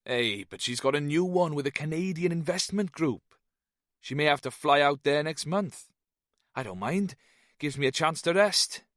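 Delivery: high lilting Welsh expat; Welsh man voice. Acted. Jones voiced, Welsh lilt.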